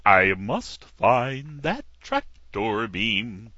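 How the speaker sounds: slow, put-on cartoon dog; cartoon dog on a space quest. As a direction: drawl it like an old cartoon hound. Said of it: "It's one of the voices that I do".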